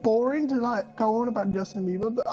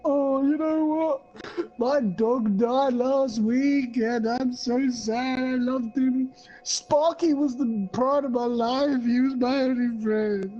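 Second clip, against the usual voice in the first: mock crying; 2 semitones higher, slower than usual. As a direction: ham up a crying man over his dead dog. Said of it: "start crying and being like".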